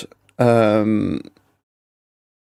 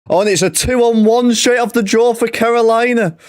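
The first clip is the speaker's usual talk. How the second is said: much higher, urgent commentator call; excited play-by-play; 11 semitones higher, faster than usual. Real, not acted. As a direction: call the sudden chance with rising excitement. Live call of a sudden chance.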